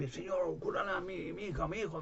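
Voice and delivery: más aguda, voz de enfermo que suplica; súplica imitada. Retold or imitated. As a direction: ruega como un enfermo desesperado. (súplica aguda imitada)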